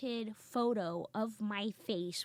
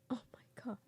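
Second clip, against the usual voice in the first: hushed, voiceless disbelief; appalled whisper; 3 semitones higher, 11 dB quieter than usual. Retold or imitated. Brothers watch sister on stage, horrified whisper. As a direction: whisper it in mock horror.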